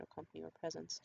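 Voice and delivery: normal talking voice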